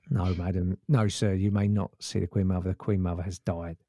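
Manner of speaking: deep, stiff copper; police officer voice. Retold or imitated. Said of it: "that's a good police voice".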